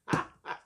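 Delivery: loud, voiceless burst of laughter; short laugh. Real, not acted. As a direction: laugh briefly at your own thought. Laughs at AI writing self-help.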